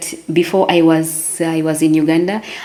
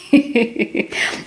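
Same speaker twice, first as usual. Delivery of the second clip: short laugh; 6 semitones higher, 3 dB quieter than usual. Real, not acted. Laughing at her broken French.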